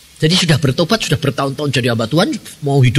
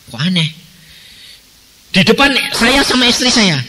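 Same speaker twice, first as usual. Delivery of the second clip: ordinary delivery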